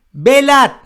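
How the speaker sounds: high, loud barked order; commanding apostle. Acted. Preacher voicing the apostle's order to the church, commanding. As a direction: cry it out as a command. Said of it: "imaginarse el tono de voz del apóstol Pablo".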